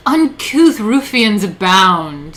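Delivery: loud, slow, haughty proclamation; mock aristocrat. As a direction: declaim it like an offended lord.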